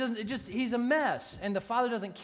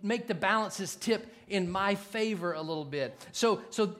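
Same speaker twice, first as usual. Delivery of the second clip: louder, slow, hammered preaching; full-force preaching; 3 semitones higher, 5 dB louder than usual. Acted. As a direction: drive each point home to the church.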